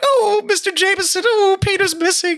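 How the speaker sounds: hammed-up, very high damsel wail; mock-distressed falsetto plea; Mary Jane. Worried woman telling Jameson Peter is missing, wailing.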